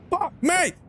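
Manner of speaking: high-pitched exasperated cry; real annoyance bursting out high; frustrated outburst. Real, not acted. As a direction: voice cracks up in frustration. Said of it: "I need to chill out a bit".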